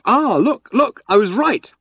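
high, eager discovery; excited. A narrator's reading. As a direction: pounce on the page. Ford excited.

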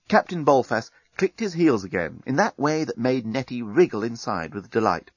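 ordinary delivery

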